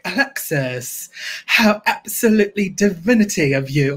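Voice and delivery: Moira Rose. Acted. Example line of the impression, affected diva voice. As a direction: linger on the name, then grandly gush. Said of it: "I need something, like, short and sweet".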